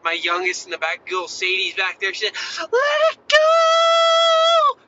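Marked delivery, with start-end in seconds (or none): 2.6-4.8 shrill full-lung scream, high and drawn out; top of her lungs. Retold or imitated. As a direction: belt it out like a little girl at max volume. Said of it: "Top of her lungs".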